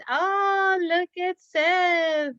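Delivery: high, slow and drawn-out wonder; delighted surprise. Real, not acted. Teacher showing Saif's sheep to the class, delighted. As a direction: marvel at it as if seeing something amazing. Teacher praising child's craft.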